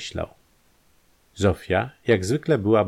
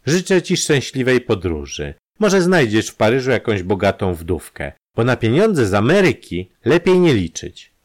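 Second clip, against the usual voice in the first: scornful farewell, higher and building louder; mocking good wishes, voice raised; contemptuous; 5 semitones higher, 5 dB louder than usual. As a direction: wish them well with open disdain. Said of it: "zmierzyła go pogardliwym spojrzeniem i powiedziała".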